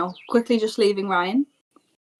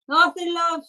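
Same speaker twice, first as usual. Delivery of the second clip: placating reply; 9 semitones higher than usual. Acted. Game master voices Tut's reply.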